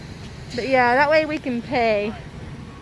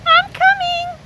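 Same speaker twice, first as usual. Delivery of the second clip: calling out; 7 dB louder, slower than usual. Real, not acted. Calling out to someone.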